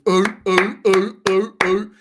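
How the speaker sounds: mock seal barking ooh ooh; seal noises. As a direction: bark like a clapping seal. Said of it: "clapping away like a seal".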